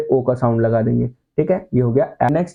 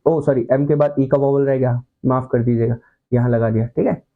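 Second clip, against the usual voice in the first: ordinary talk, nothing marked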